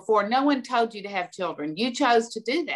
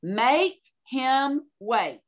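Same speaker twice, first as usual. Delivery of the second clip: very slow, word by word; stern command; 3 semitones higher, much slower than usual. Retold or imitated. Headmistress assigning parents homework, stern and drawn out. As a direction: draw out each word like an order. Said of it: "and I mean it".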